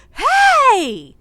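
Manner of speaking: loud, high-pitched holler from a crowd; shouting. Retold or imitated. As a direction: imitate a rowdy yell, drawn out. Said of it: "And a buttload of shouting".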